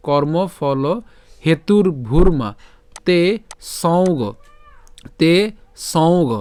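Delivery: slow, measured shloka recitation with drawn-out long vowels; Sanskrit verse recitation. Shown as a demonstration. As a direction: recite it slowly in careful Sanskrit. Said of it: "এটা আমরা একসাথে উচ্চারণ করব". (ধীরে শুদ্ধ সংস্কৃত উচ্চারণে শ্লোক পাঠ)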